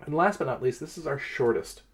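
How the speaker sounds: ordinary delivery, nothing marked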